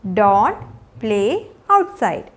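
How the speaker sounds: slow, with long pauses between words. Shown as a demonstration. Teacher modelling a rainy-day rule for learners, word by word. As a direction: give each word alone, low at first.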